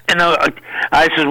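usual speaking voice

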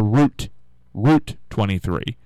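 route said as "root"; long oo in route; "root" pronunciation. Shown as a demonstration. Host trying another way locals wouldn't say, route as "root". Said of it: "don't think we would say route".

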